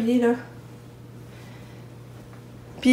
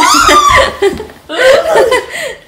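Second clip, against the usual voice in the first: high laugh; 8 dB louder than usual. Real, not acted. Tickle laugh.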